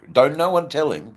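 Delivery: high, loud mock warning; loud, high-pitched playful plea. Jokingly warns others to keep secret.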